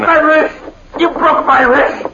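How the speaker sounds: pained high shout; shouting while wounded. Acted. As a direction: cry out from a broken wrist. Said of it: "and he screamed in pain".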